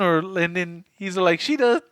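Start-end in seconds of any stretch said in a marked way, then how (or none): 1.4-1.8 mock creature voice. Monster replies menacing.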